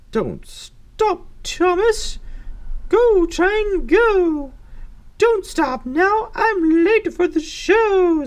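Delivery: high, loud, insistent judge voice; fretting judge. Acted. Judge late for the show, high insistent pleading. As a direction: stretch out the pleas in a high character voice. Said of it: "says the judge".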